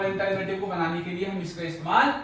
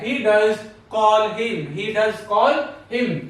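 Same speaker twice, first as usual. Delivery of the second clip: very slow, He does call him word by word; slow dictation; much slower than usual. Shown as a demonstration. Teacher dictating an emphatic example slowly. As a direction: spell out the sentence slowly for the class. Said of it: "जोर देने के लिए".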